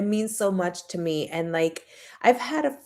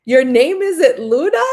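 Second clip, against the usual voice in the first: louder, higher startled question; mock-shocked friend, voice climbing; shocked disbelief; 6 semitones higher, 9 dB louder, slower than usual. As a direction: imitate her double take. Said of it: "she was like".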